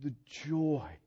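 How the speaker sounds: breathless whisper of wonder; hushed awe. Retold or imitated. Parent recalling his daughter's birth, whispered awe. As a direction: whisper it through tears of joy. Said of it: "sometimes you just go".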